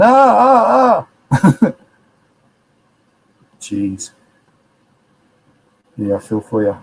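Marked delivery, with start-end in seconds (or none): 0.0-1.7 high, loud pained ah ah ah, hammed up; imitated pain groans. Host imitating a viewer in pain from a dislocated knee, mock groans. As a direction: mimic someone wincing with a dislocated knee. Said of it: "with the dislocation going".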